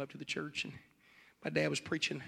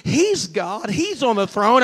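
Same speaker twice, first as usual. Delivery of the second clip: ordinary talk, nothing marked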